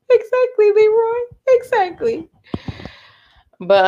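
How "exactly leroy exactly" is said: high-pitched "Exactly", squealed in agreement; emphatic agreement. Real, not acted. Agreeing with commenter Leroy, high and emphatic. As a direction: shoot the pitch way up.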